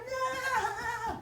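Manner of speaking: high, shrill bratty scream of no; tantrum. Acted. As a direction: throw a childish tantrum. Child's tantrum voiced.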